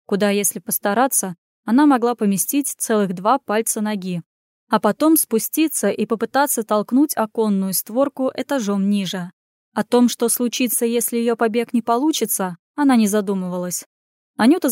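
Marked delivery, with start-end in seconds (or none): none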